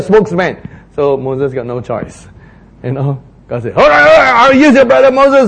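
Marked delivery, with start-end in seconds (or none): 3.7-5.5 higher and louder, giving in impatiently; exasperated God. Acted. Preacher voicing God relenting to Moses, exasperated. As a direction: concede like a fed-up God.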